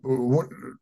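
ordinary delivery

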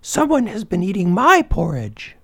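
medium-sized bear voice, pitched well up; high storybook she-bear; Mama Bear. A narrator's reading. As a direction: cry out as an indignant mother bear. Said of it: "cried out in her medium-sized voice".

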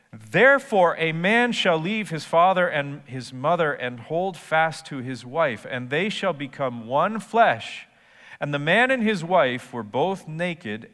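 high, loud solemn reading; emphatic Bible reading; scripture read with force. Acted. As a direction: proclaim the verse.

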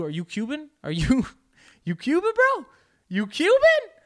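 high, loud mock disbelief; hammed-up mocking uncle; teasing Mexican uncles. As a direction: tease him with squeaky incredulous questions. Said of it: "my Mexican uncles, they make fun of me".

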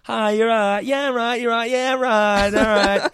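very high, mocking English accent; squeaky parody of English friends greeting each other; Nicola. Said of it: "take the piss out of Nicola".

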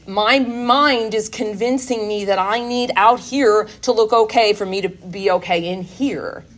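usual speaking voice

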